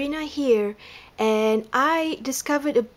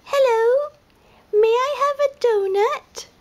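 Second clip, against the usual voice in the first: much higher, louder, sweet little pleading voice; tiny lemur voice; 5 dB louder than usual. A narrator's reading. Lemur politely asks panda for a donut, very high. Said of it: "the lemur asks".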